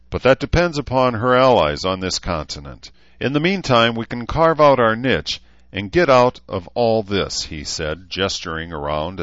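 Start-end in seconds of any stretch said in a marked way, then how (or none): 0.1-7.4 lightly voiced leader, higher and louder; a hint of a leader winning over followers; self-styled leader, persuasive. A narrator's reading.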